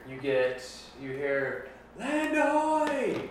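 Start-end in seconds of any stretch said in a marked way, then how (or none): 2.0-3.2 long, high lookout's call; shouting from crow's nest. Acted. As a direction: call out from the top of the mast. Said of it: "from the crow's nest".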